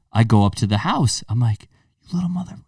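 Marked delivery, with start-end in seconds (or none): 2.0-2.6 freaking out. Retold or imitated. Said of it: "freaking out on him".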